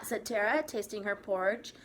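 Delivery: ordinary delivery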